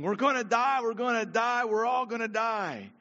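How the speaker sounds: loud, high panicked cry; frantic shipwrecked man screaming. Acted. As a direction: yell it like a man sure he's doomed. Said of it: "one of them started screaming".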